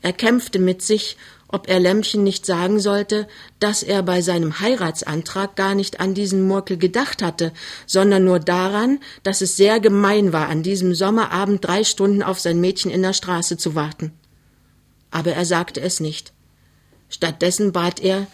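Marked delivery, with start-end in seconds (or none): none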